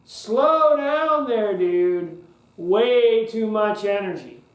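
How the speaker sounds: loud, drawn-out protest; overwhelmed "whoa". Acted. Kinesthetic listener to an overeager speaker, slow loud protest. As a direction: call out a long whoa, slowing them right down. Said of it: "the kinesthetic people's like".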